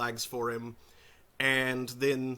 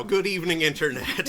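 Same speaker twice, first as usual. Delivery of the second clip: loud host greeting; 5 semitones higher, 7 dB louder than usual. Acted. Host opens the show.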